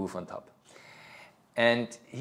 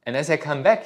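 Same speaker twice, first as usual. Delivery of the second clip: normal talking voice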